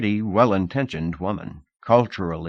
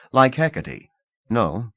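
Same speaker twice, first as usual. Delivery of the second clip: usual speaking voice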